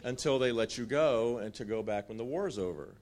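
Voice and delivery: normal talking voice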